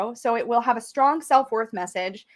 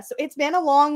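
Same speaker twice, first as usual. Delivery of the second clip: ordinary delivery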